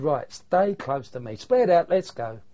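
firm loud command, slow start; loud order. Retold or imitated. Sergeant commanding his men to move out, loud and firm. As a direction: order your men forward.

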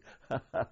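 brief laugh at his own joke; amused laugh. Real, not acted. Former prisoner joking about the guard's cigarette haul, amused chuckle.

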